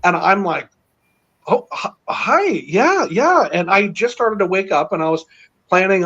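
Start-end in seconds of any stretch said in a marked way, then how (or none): 1.5-3.5 higher, stammering awestruck hello; flustered starstruck greeting. Retold or imitated. Man retelling meeting Trump in a dream, higher flustered stammer. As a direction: gush a nervous hi at a celebrity.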